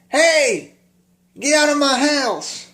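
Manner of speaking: high-pitched drunken mock holler; Doyle. Acting out drunk Doyle ordering people out, crazy mock yell. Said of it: "wild up and just going like crazy".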